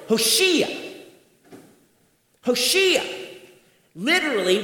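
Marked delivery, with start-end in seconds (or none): 0.1-3.3 high, ringing cry of Hoshea; crowd's cry. Retold or imitated. Preacher echoing the crowd's cry, high and ringing. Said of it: "they are shouting".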